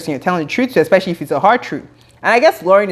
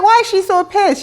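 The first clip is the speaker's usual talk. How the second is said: incredulous question; 12 semitones higher than usual. Retold or imitated. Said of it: "I'm like".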